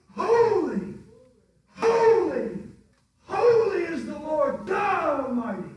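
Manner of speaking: loud, drawn-out holy proclamation; fervent preaching. Acted. Pastor to congregation, crying out holy at full force. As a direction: cry out each holy like a revival climax.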